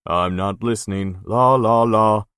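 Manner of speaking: loud sing-song la la la; la-la-la drowning out. Acted. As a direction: drown her out childishly. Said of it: "put his hands over his ears".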